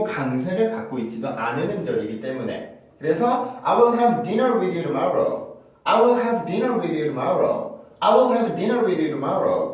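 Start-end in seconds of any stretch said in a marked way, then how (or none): 3.6-9.7 "tomorrow" begun with a light tap instead of T; slow model sentence, flapped T in "tomorrow". Shown as a demonstration. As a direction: blend "you tomorrow" as "you-duh-morrow". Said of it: "이렇게 발음하는 경우가".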